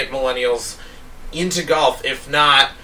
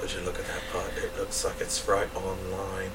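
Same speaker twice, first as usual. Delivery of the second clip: hushed golf commentary; 3 semitones lower, 8 dB quieter than usual. Pretend.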